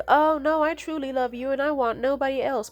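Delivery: high, gushing imitation of false love; mock devotion. Cheating boyfriend swearing love, high mock-devoted imitation. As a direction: overdo the lovestruck pledge.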